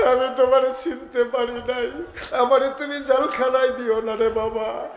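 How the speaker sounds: acted high, pleading voice; tearful plea. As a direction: beg for mercy as the frightened mother. Woman begging pardon, repeated higher.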